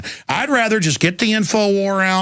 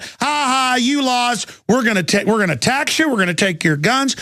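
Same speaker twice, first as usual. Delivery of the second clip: jeering taunts; 6 semitones higher than usual. Retold or imitated. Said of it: "vitriolic celebration I see".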